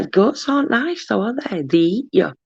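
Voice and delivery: ordinary delivery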